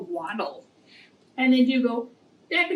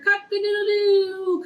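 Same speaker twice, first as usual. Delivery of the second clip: crowed much higher and louder, like a real rooster; 8 semitones higher, 5 dB louder than usual. Shown as a demonstration. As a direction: belt it out like a rooster at dawn. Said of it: "Please say the songs and the s-, sounds along with the animals".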